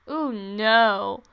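loud, low-pitched string of protest; loud dismay. Real, not acted. Gamer who doesn't know how to edit, loud dismay.

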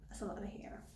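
breathless whisper, barely audible. Real, not acted. Repeating it to herself over the cut hair, whispered. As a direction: whisper it to yourself in disbelief.

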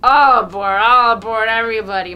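loud bellowing call to climb on; drunken yell. A narrator's reading. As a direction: holler it across the square. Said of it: "Mokolka yells".

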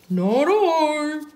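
high, drawn-out duck voice; storybook duck. A narrator's reading. Duck refuses to help water, high character voice. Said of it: "said the duck".

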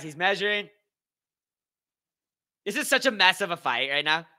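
usual speaking voice

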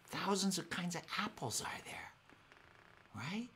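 a soft whisper of wonder. Real, not acted. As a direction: hush it as if sharing a marvel. Marvelling at kinds of apples.

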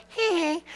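small, high, soft "hee hee"; gleeful giggle. Retold or imitated. Gloating over minimum wage earners.

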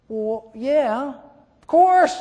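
put-on high, obvious child's answer; a kid stating the obvious; young boy. Chad answering the sheriff, high and matter-of-fact.

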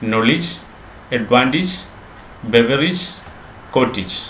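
നോളജ്, അഡ്വാൻറ്റേജ്, ബെവറേജ്, കോട്ടേജ് all ending in short "-ij"; correct pronunciations. Shown as a demonstration. Teacher recapping, short "-ij" on നോളജ്, അഡ്വാൻറ്റേജ്, ബെവറേജ്, കോട്ടേജ്. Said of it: "ഇനി മുതൽ".

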